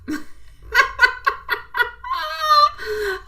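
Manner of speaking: high cackling witch laugh; shrieking high witchy cackle. Real, not acted. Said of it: "he's got my witchy laugh".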